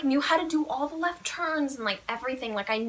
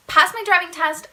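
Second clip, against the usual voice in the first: ordinary delivery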